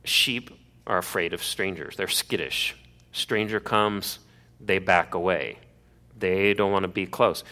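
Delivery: ordinary talk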